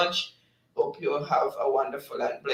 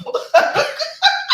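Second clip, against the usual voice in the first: high, loud squealing laugh; real laughing fit; 7 dB louder than usual. Real, not acted. Host cracking up at a chat message, laughing fit. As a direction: burst out in helpless high laughter.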